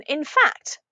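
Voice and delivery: ordinary delivery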